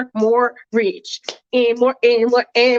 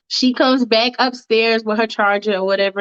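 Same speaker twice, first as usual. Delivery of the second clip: usual speaking voice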